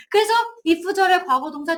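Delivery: ordinary talk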